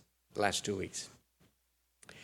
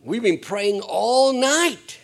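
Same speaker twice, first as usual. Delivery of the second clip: put-on higher, slow, proud young voice; young man proud; 9 semitones higher, 7 dB louder, slower than usual. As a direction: stretch it out like a beaming youngster. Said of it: "And he said".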